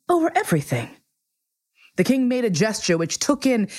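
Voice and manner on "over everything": high, regal pronouncement falling at the end; magnificent simplicity. A narrator's reading. King repeating his claim to the prince, with magnificent simplicity. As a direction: proclaim it grandly in one phrase. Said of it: "said the king with magnificent simplicity".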